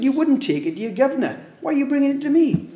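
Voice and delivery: a quick, high-pitched divine reproach; indignant rebuke. Retold or imitated. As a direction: scold them quickly for shoddy gifts. God rebuking in Malachi.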